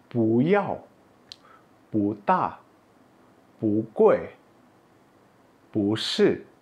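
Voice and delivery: each pu rises before a falling word; second-tone pu. Shown as a demonstration. Teacher reading examples, every pu in rising second tone. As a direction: lift pu, then drop yao, ta, gui, shi. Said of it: "Ini pu dengan nada dua".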